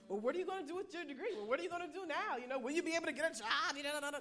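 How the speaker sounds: quick, nosy rapid-fire questioning; mock prying questions. Relatives back home pestering her about her degree, mock prying. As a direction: rattle off the busybody questions fast.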